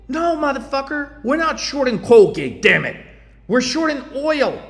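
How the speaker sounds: high, loud exasperated outburst; exasperated yell. Acted. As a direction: blow up at the clueless trader. Yelling at clueless trader.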